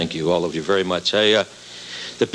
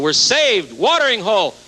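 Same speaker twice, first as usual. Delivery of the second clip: higher, joyful cry of relief; excited relief; 9 semitones higher than usual. Acted. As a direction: shout like men saved from thirst. Desperate soldiers spot water.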